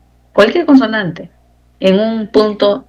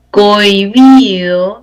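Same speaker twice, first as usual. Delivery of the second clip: "Cohibido" stretched slowly and louder; drawn-out example word; 5 dB louder, much slower than usual. Shown as a demonstration. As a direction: sound out "Cohibido" slowly, lingering on the joined "oi". Teacher sounds out example word.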